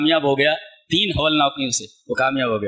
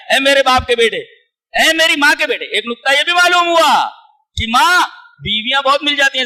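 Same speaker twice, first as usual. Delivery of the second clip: loud, higher fervent preaching; 9 semitones higher, 5 dB louder than usual. Real, not acted. Preacher stressing the mother's rank to the congregation, fervent. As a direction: press the point with full force.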